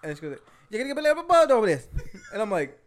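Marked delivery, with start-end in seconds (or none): 0.7-1.9 fast, high mock Jamaican accent, words running together; slurred accent imitation. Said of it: "she spoke in cursive".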